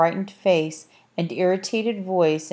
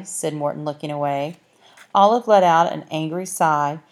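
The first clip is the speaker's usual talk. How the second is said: ordinary delivery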